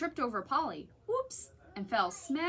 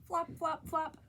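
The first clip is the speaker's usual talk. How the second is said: higher, bouncy flop sounds; sound effect; 6 semitones higher than usual. A narrator's reading. Reading to children, playful higher flops. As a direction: hop each word like a floppy foot.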